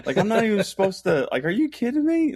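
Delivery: high, loud rising disbelief, still half-joking; incredulous. Retold or imitated. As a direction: act like the question is absurd. Player's disbelief at being called failure.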